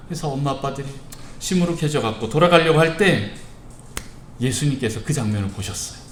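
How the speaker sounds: ordinary delivery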